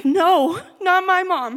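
high, loud anguished yell. Retold or imitated. Daughter yelling as she hears her mom died. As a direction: cry out no against the news. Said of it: "I begin yelling over and over again".

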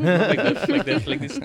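high, loud playful imitation of the mom; squeaky, loud mom impression; mimicking the quirky mom. Mimicking the mom character's wink.

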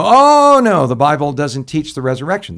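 a scornful "Oh" that jumps high and loud, then scoffing; mocking skeptic. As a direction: scoff at the idea like a doubting Sadducee. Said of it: "they're gonna go".

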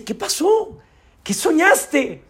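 high, slow, concerned questions; wife worriedly asking her husband what he dreamed. Acted. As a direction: ask gently, alarmed. Wife worried after his nightmare.

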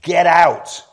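high, bellowed command; indignant loud cry. Acted. Preacher as Jesus crying out to death, indignant bellow. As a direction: roar it to drive death out. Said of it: "cries out in a loud voice to death".

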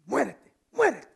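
loud, drawn-out mock curse; hammed-up wishing someone dead; mock death wish. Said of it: "y decir".

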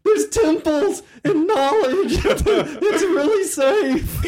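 high, slow, wheedling plea; hammed-up begging the party to go in; pleading GM. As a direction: coax them like a desperate salesman. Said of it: "looking at Wayne almost, pleading".

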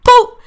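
sudden, very loud "boop" of a blow; imitated punch sound. Storyteller recounting being punched in the face, explosive punch noise. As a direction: burst out with the impact noise.

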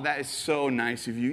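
ordinary talk, nothing marked

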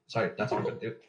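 ordinary talk

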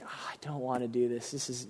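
quiet, reluctant mumble under the breath; reluctant groan. Retold or imitated. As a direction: sound like you really don't want to. Reluctant pastor retold.

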